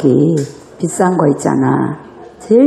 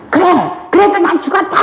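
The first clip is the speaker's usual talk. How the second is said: much higher, shouted comeback; mock defiant retort; 11 semitones higher, faster than usual. Spouse retorting in the quarrel, mock defiant shout. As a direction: snap back in defiance. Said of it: "막 이러면서 서로".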